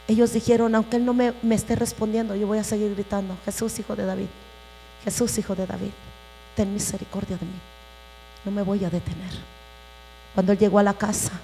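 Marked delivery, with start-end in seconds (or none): 0.7-9.4 hushed plea. Retold or imitated. Blind men's persistent plea, retold.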